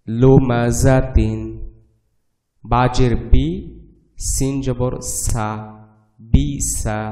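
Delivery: letter by letter with each vowel mark named, slow and clear; slow Quran spelling drill. Shown as a demonstration. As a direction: spell each word out like a teacher for beginners. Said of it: "আমি আবারও এই শব্দটা বানান করে দিচ্ছি". (ধীরে ধীরে বানান করে পড়া)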